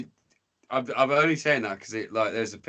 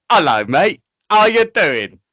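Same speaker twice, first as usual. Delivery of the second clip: much higher and louder, a chirpy mock-posh British hello; posh English greeting; 6 semitones higher, 11 dB louder than usual. UK lad in the Bronx, greeting the locals in a loud, high, cheery mock-posh voice. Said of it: "all I think they heard was".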